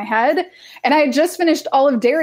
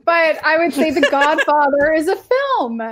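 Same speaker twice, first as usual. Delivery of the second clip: lightly snobbish verdict; 5 semitones higher than usual. Retold or imitated. Mock-snob verdict on Godfather.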